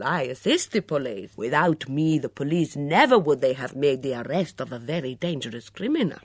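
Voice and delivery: put-on playful impersonation of a proud foreign cook's accent; Mitzi's accent. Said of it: "Julia produced a very good imitation of Mitzi's accent".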